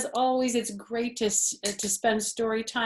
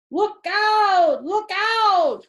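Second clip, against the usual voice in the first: very high, loud shout; warning shout; 12 semitones higher, 10 dB louder, much slower than usual. Acted. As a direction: yell a warning across the yard. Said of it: "cried a voice".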